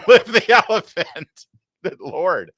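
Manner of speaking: high and loud, laughing while reading. Real, not acted. Host reads a viewer's joke and bursts out laughing. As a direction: crack up on the last words.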